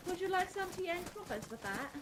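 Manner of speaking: pinky up, ask like a Brit; British impression. Friend joining in with a posh British tea offer. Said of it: "best British impressions".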